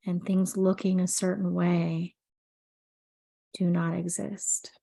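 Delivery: soothing sleep meditation read; guided meditation. Acted. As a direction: guide the listener gently into calm, unhurried. Leads an anxiety meditation.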